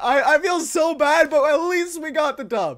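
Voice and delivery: words cracking with laughter; laughing, near tears. Real, not acted. Gamer winning the round, laughing near tears. Still laughing after the win.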